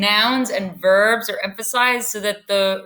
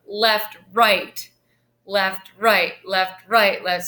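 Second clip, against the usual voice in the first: marching count with "right" hit harder than "left"; stress on RIGHT; slower than usual. Shown as a demonstration. Teacher imitating stomping volunteers, "right" stressed after each "left". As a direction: lean on each "right" like stepping on the gas. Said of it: "they'll emphasize the right".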